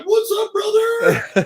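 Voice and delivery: very high, drawn-out shout; loud mock whoop of hello; exuberant bro greeting. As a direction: imitate an over-friendly holler, stretched long. Repeats driver's greeting.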